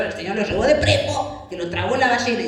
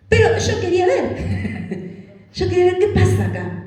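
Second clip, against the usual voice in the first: laughing Elijah; 10 semitones higher, 3 dB louder than usual. Pretend. Elijah voiced wanting to stay.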